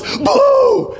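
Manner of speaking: sudden high, loud mock Boo!; jump scare. Joking husband jumps out of the cupboard, loud mock boo. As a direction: pop out and spook her. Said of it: "is behind the cupboards and goes".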